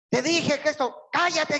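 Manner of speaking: imitating a furious comeback; angry shout back. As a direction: bark back in anger. Answering anger with anger.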